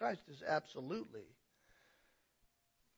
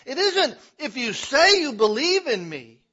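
laughing preacher; 9 semitones higher, 16 dB louder than usual. Real, not acted.

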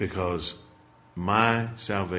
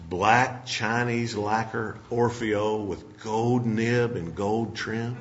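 slow savoring read; much slower than usual. Retold or imitated. Preacher reading the pen box aloud, slowly savoring each word. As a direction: read the fancy name like it's precious.

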